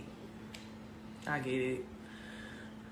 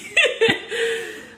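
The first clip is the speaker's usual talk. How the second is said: sudden high, loud burst of laughter; big laugh; 6 dB louder than usual. Real, not acted. Laughing at the Big Comfy Couch joke, high burst of laughter.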